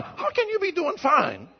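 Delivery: normal talking voice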